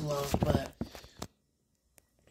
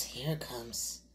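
whispering; 3 semitones lower, 8 dB quieter, slower than usual. Real, not acted. Bracing to eat the pepper.

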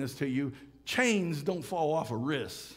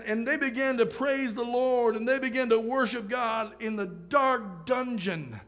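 raised, driving preacher's delivery; impassioned preaching; 6 semitones higher than usual. Real, not acted. Preacher rallying the congregation to advance, impassioned preaching. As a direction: preach it out with stretched key words.

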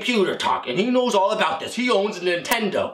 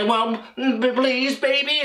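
high, stammered begging; pleading; 6 semitones higher, slower than usual. Acted. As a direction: beg your wife, pitched right up. Pleading with wife who throws him out.

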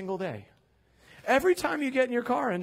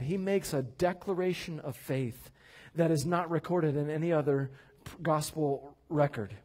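ordinary talk, nothing marked

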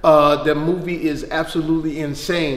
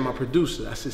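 ordinary talk

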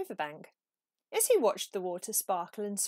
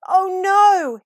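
much higher and louder panicked cry; cry of alarm; 10 semitones higher, 17 dB louder than usual. A narrator's reading. Peep crying out on realizing he is late. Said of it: "he cried".

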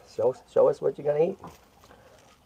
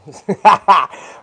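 laugh; 5 semitones higher, 12 dB louder than usual. Real, not acted. Squirrel won't go in pen.

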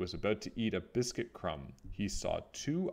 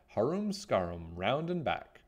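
plain reading voice

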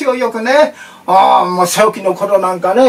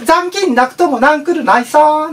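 Okinawan dialect; 8 semitones higher than usual. Retold or imitated. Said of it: "沖縄の本店".

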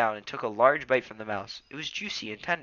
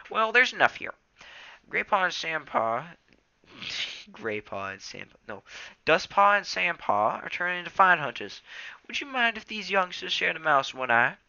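put-on old tom cat voice; 7 semitones higher, 3 dB louder than usual. A narrator's reading. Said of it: "meowed Small Ear".

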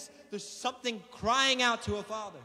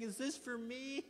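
retold weeping plea; 10 dB quieter, slower than usual. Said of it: "just breaking and weeping".

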